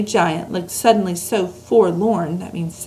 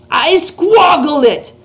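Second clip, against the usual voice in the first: higher, loud, slow giant outcry; disgusted cries; 6 semitones higher, 5 dB louder, slower than usual. A narrator's reading. Giant crying out his disgust at the snozcumber, loud and high. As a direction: hurl each word at the snozcumber. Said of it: "cried the B F G, waving it about".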